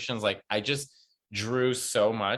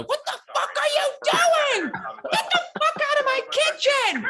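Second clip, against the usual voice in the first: very high, loud, laughing mock rage; hammed-up mother screaming at her daughter over broken pasta; mock Italian mother yelling; 5 dB louder than usual. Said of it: "and then her mother".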